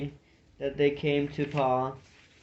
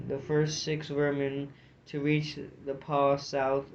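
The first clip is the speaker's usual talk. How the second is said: ordinary delivery, nothing marked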